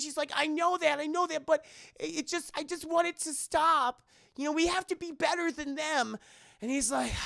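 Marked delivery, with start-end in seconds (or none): 0.3-6.2 put-on high girl's voice, imploring; acted, high-pitched appeal; mock pleading. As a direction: plead earnestly in a high imitation voice. Voicing the girl's plea.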